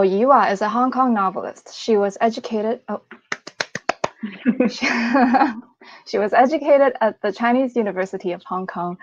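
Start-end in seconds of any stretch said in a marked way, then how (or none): 3.3-5.6 laughing at own slip; breathy giggle breaking into voiced laughter; amused laugh. Real, not acted. Author slips while reading a colleague's bio, amused laugh.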